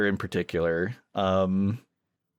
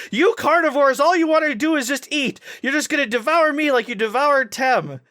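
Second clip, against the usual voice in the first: much higher and louder, a mock furious accusation; accusing outburst; 14 semitones higher, 5 dB louder than usual. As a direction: hurl the accusation at the carnivore. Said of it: "she turns around and goes".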